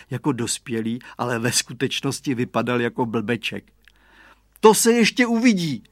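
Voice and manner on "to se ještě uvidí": put-on high, loud, defiant boy; determined. A narrator's reading. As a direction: declare it with resolve, pitched up and forceful. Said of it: "prohlásil odhodlaně Radovan". (odhodlané, hlasité prohlášení)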